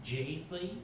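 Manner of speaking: soft, formal mock voicemail; understated mom on a pager message; mom's voicemail. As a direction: leave a stiff message for your son. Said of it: "same voicemail".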